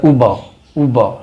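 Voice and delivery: Uber said oo-ba, no hard r; brand name pronounced. Shown as a demonstration. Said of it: "इसको Uber नहीं पढ़ना".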